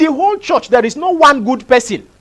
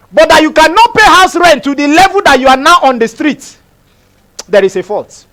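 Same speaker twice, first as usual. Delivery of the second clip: raised, emphatic preacher voice; high-pitched, hard-hit preaching; forceful preaching; 7 semitones higher, 5 dB louder than usual. Real, not acted. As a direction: drive each point home to the congregation.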